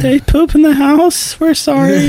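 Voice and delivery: high, slow, sheepish owner voice; mock apology. Owners apologizing to Joe, high and sheepish. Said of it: "Joe's like".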